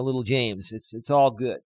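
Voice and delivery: ordinary delivery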